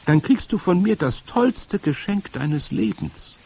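high-pitched luring stranger; eerie high-voiced woman; strange witch voice. A narrator's reading. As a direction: coax the child in a high witchy voice. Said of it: "Ihre Stimme hatte einen merkwürdigen, rasselnden Klang".

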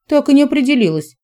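quick, high, amused aside; wry aside. A narrator's reading. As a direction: toss it off with a shrug. (ироничная быстрая ремарка)

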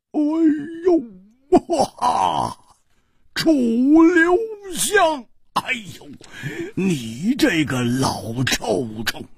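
high, stretched-out whoop of delight; laughing outcry. A narrator's reading. Friend jumps up seeing his old pal, whooping with laughter. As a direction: crow the name slowly, overjoyed. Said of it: "也跳起来大笑道".

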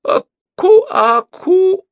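very high, loud repeated cries; frantic high-pitched outbursts; shouting. A narrator's reading. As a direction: yelp it over and over like a fit. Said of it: "закричал консул".

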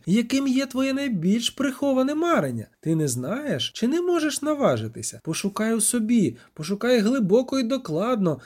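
high-pitched, insistent demand; hissed challenge. A narrator's reading. Sorceress goading the witcher to name his wish, hissed challenge. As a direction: press him hard, pitch lifted high. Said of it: "просичала вона".

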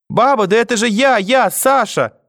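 high, loud, insistent plea; desperate child. A narrator's reading. Grandson begging grandmother to recognise him, panicked. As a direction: cry out, stretching the last words.

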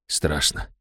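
barely voiced, low and hushed; frightened whisper. A narrator's reading. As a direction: breathe the word out in terror. Fear while submerged in cold water. (испуганный шёпот)

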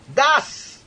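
high, loud bellow; booming drunken outburst; thunderous shout. A narrator's reading. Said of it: "прокричал он громовым голосом".

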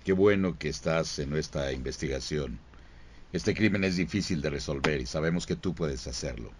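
low, soft serious agent briefing; stern detective giving orders; CSI investigator voice. Acted. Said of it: "así empieza él".

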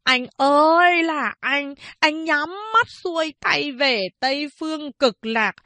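high, slow, shrill mourning lament, hammed up; a fat boy parodying a widow's keening, dragging every word; mock widow's wail. Schoolboy mocks a wailing widow for classmates, high slow lament. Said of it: "Nó nhại giọng the thé của bà Góa, khóc lành lót kể lể".

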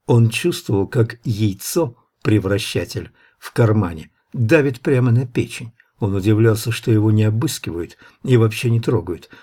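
ordinary delivery, nothing marked

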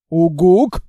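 loud hoot rising like a question. Acted. Monster hoots a question to the sad girl.

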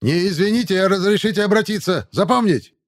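loud, high barking sergeant; shouting drill sergeant. A narrator's reading. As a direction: bellow at the new recruits. Sergeant corrects recruit.

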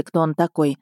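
plain reading voice

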